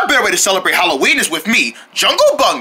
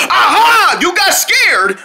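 loud triumphant shout; gloating shout; 11 semitones higher, 4 dB louder, slower than usual. Acted. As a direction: gloat at his scare. Gloating after scare.

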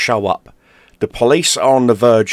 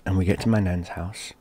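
ordinary delivery, nothing marked

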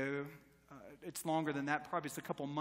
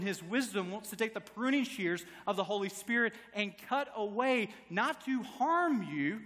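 louder, driving sermon delivery; preacher building to full-voiced exhortation; preaching; 5 semitones higher, 6 dB louder than usual. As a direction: press the questions home to the congregation. Preacher's appeal to congregation.

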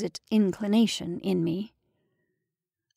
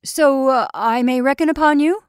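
higher, louder call; raised call down the corridor; shouting after him; 5 semitones higher, 7 dB louder than usual. A narrator's reading. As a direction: call after someone leaving. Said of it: "I shouted after him".

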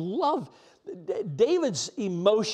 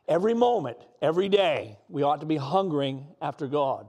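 preaching; 4 dB louder than usual. Acted. Pastor exhorts believers at full voice.

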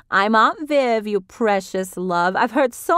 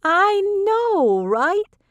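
high, loud gushing agreement; excited Vivian; 14 semitones higher, 5 dB louder, much slower than usual. A narrator's reading. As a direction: agree eagerly, much higher.

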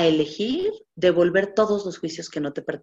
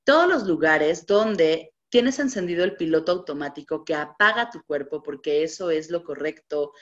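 ordinary delivery

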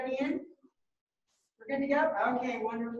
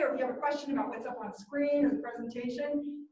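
ordinary talk

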